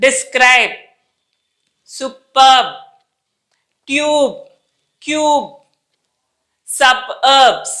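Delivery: teacher's careful final-B drill; pressed final B. Shown as a demonstration. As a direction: DESCRIBE to సబర్బ్స్, each ending on a firm B. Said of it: "చాలా బిగిచ్చి ఒత్తి పలుకుతాం".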